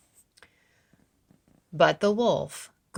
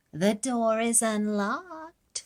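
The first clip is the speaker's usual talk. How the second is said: fake Granny voice; 6 semitones higher, slower than usual. A narrator's reading. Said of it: "said the wolf".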